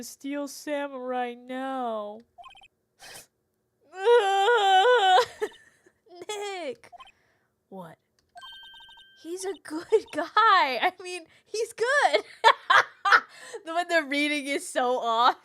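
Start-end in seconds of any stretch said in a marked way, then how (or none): 3.9-5.5 shrieking burst of laughter; loud high laugh. Real, not acted. Cracking up at the voice. 6.3-15.4 talking through giggles; laughing. Real, not acted. Laughing while praising actor.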